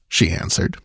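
ordinary delivery

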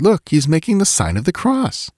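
high-pitched, swooping exclamation; crying out. A narrator's reading. Narrator to Swede about the man in the boat, crying out. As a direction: cry it out urgently. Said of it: "I cried".

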